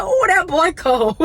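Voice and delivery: high, loud hype for the shooter; excited cheer. Retold or imitated. Auntie cheering in the bleachers, excited and loud. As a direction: hype up the hot shooter from the stands. Cheering nephew's teammate at game.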